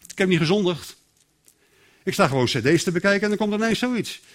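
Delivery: ordinary talk, nothing marked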